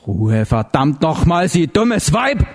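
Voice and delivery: drawn-out start, rising to a high finish; angrily snapping at her. A narrator's reading. Heller silencing the raving neighbour, angry outburst. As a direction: let exasperation climb into a cry. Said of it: "fuhr Heller sie an".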